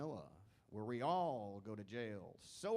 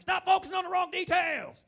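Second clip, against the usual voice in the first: loud high exasperated yell; exasperated shout; 12 dB louder than usual. Retold or imitated. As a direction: shout it out, fed up. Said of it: "I'm like".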